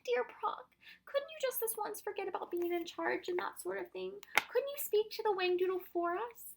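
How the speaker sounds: put-on high, pleading little girl; imploring. A narrator's reading. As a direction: coax and beg in a small high voice. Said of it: "She gazed imploringly".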